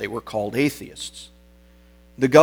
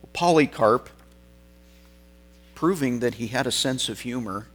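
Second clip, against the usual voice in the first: ordinary talk, nothing marked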